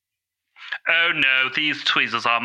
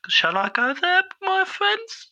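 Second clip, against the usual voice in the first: much higher, mock-grand "my friends"; theatrical high question. Gamer asks the viewers where to go, high theatrical. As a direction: sing it up like a showman.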